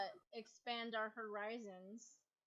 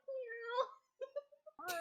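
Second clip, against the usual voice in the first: high meow through laughter; kitten voice; 11 semitones higher than usual. Retold or imitated. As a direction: mew like a kitten while laughing. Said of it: "and then we're like".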